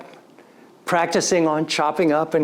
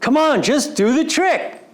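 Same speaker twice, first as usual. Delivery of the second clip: higher, louder impatient scolding; impatient dad voice, fed up; irritated father; 10 semitones higher, 3 dB louder than usual. Retold or imitated. His own father irritated at him as a boy, impatient. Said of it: "He'd be irritated".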